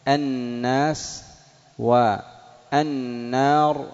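الناس said slowly with the lam merged into a doubled nun; slow recitation. Shown as a demonstration. Teacher showing students how the lam assimilates into nun. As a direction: draw out الناس, lam fully absorbed. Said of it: "نحو".

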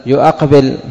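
يؤقبل as "yu-aqbil", hamza sounded; hamza kept in يؤقبل. Shown as a demonstration. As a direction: keep a glottal "a" after "yu" in يؤقبل. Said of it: "لم يحذف شيء". (يؤقبل بإثبات الهمزة مفتوحة بعد الياء)

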